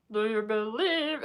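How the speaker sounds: slow, high, belting [name] voice; mock [name] belting out the line; [name] impression. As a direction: do her doing [name]. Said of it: "doing an impression of [name]".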